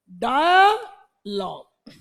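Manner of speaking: "डायलॉग" stretched out very slowly; very slow word. Shown as a demonstration. Teacher modelling a word for the class, very slowly. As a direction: draw "डायलॉग" out long. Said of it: "डाय माने दो".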